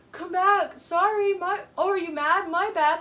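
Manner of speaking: high, loud fake apology; imitating him playing innocent; mock innocence. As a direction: act dumb, as if you did nothing. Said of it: "get people upset and then act dumb".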